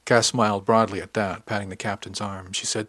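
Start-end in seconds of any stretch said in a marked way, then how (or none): none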